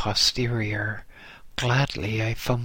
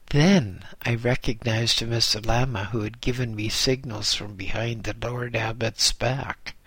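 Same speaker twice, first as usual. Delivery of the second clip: ordinary delivery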